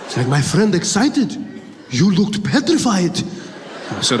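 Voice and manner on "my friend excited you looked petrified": put-on slow, puzzled Middle Eastern accent, lilting at the ends; imitated Middle Eastern accent. Airline man to startled passenger, puzzled imitated Middle Eastern accent. Acts the foreign crew member replying.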